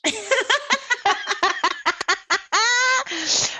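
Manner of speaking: long high peal of laughter; real laugh, high and sustained; hearty laughter. Real, not acted. Mothers amused by the son's reply, long high laugh.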